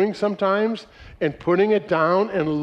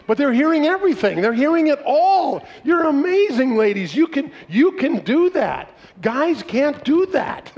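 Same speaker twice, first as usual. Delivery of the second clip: excited praise; 8 semitones higher than usual. Real, not acted. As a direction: gush about how amazing women are. Praising women's listening to audience.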